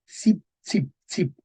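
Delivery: cip said as tsip, repeated in isolation; syllable drill. Shown as a demonstration. Teacher drilling the old sound, syllable by syllable. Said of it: "Se pronuncia ts".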